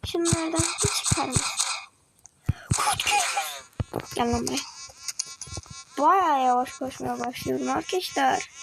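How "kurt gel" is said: high whisper call. Real, not acted. Calling the wolf.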